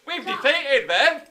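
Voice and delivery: high, loud, drawn-out mock victory shout; triumphant cheer. Teacher plays the English thinking they have won, hammed-up triumph. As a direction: crow it slowly to the whole army.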